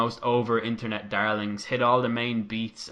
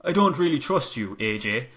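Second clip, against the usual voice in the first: Irish accent. Shown as a demonstration. Finn telling AJ he doesn't trust him, light Irish accent. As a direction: slip into a light Irish lilt. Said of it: "I can do his accent".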